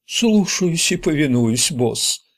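high, slow put-on servile voice; mock obedience. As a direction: draw it out high, falling at the end. Ричи шутливо подчиняется. (с шутовской покорностью, нараспев)

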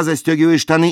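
normal talking voice

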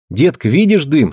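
put-on high, sudden boy's question; surprised child. A narrator's reading. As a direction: spot the smoke and blurt it. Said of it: "спросил вдруг Артёмка".

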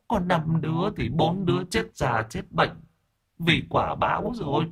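put-on high, soft young woman's voice; ghost girl's voice. A narrator's reading. Ghost girl telling the exorcist about her killers, high and soft. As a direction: answer like the sad ghost girl.

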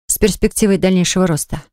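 ordinary delivery

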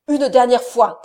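loud booming command; the count thundering at his wife, loud. A narrator's reading. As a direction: thunder it at her. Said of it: "cria-t-il d'une voix tonnante".

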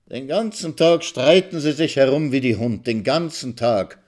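put-on old woman complaining from the window; venomous groan. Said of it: "ächzt die Alte giftig".

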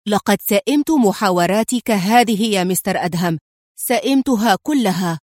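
ordinary delivery, nothing marked

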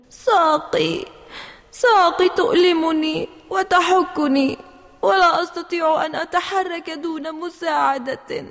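put-on high, tearful child's complaint; crying. A narrator's reading. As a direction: whimper about a sore, itching leg. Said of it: "لماذا تبكين يا جود".

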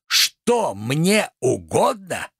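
very slow, swooping theatrical question; outraged echo. Acted. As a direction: stretch out every word in disbelief. Tragedian repeats question, more outraged.

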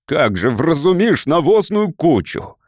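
father's contemptuous angry shout; angry exclamation. A narrator's reading. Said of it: "воскликнул отец, гневно сверкая глазами".

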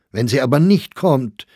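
high, loud worried objection; anxious outcry. A narrator's reading. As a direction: cry out in agitation. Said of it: "rief Sachsberger aus".

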